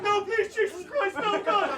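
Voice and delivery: high, loud mock-hysterical pleading; panic attack. Stag sees the blacked out goggles, hammed-up panic. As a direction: imitate a man begging in sheer panic. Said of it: "Will has a full-on panic attack".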